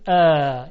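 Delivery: short thin vowel ا, loud; thin ا said clearly; light alef. Shown as a demonstration. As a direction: front light a sound. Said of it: "صدای ا رو تلفظ کنید".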